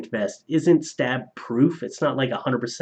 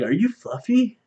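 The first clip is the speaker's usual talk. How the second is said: mock fan question, much higher; imitating an eager fan; 10 semitones higher than usual. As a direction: squeak it like an excited stranger. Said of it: "they'll be like".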